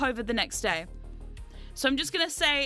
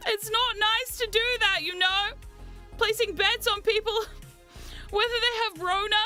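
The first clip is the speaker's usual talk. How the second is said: mock whining; 8 semitones higher than usual. Mock complaint about chat betting.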